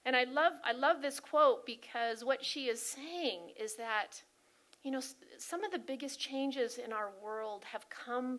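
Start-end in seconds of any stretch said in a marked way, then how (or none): none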